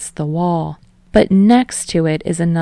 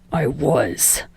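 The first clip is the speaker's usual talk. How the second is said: lower, softer, through clenched teeth; seething; 3 semitones lower, 4 dB quieter than usual. A narrator's reading. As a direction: bite it off through your teeth. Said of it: "seethes from between clenched teeth".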